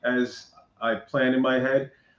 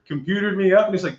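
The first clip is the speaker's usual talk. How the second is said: ordinary delivery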